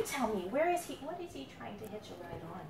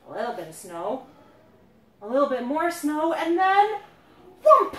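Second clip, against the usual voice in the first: growing louder up to a big whoomp; building to a crash; 3 semitones higher, 13 dB louder than usual. A narrator's reading. Parent building suspense for the kids, rising to a loud whoomp. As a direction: build the suspense, then burst out. Snow piling up, then crash.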